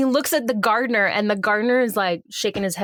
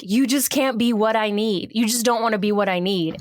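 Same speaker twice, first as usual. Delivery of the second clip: ordinary talk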